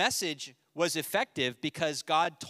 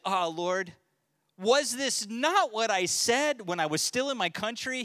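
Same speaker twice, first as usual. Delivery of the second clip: higher, louder, whining protest; indignant complaint to God; 7 semitones higher, 5 dB louder than usual. Retold or imitated. Jonah complaining to God, a mild indignant whine. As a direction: plead like you were right all along. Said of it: "he prayed to the Lord and said".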